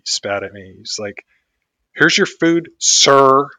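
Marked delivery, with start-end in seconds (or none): 1.9-3.5 "sir" hit hard and loud after a pause; pause, then land "sir" like a jab, higher and louder; pointed "sir". Retold or imitated. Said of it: "the pause and then the very direct "sir".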